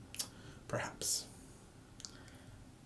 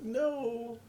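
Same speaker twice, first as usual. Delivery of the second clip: much higher, slow, pleading put-on voice; mock broken-English plea; 12 semitones higher, 3 dB louder, much slower than usual. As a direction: play a self-mocking American fumbling to explain, high and slow. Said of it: "And I'd be like, "Who's this American pretending to be Spanish?".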